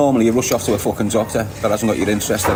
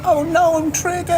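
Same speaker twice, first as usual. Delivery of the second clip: much higher, hammed-up panic; mock alarm. Streamer mocking his own trigger word in hammed-up alarm. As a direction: squeal it in fake horror, laughing.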